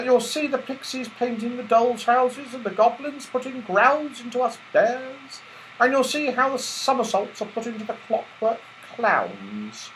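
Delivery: high, polite footman voice; teddy bear footman. Acted. Footman bear welcoming the toys at the door, high and polite. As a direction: welcome the guests grandly.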